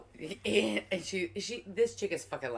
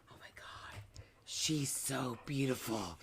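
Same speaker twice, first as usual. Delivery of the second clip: mock awed whisper; 5 semitones lower, 9 dB quieter, slower than usual. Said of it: "He goes".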